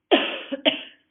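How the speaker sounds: sharp dry cough; coughing fit interrupting. Real, not acted. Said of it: "sorry, I'm coughing".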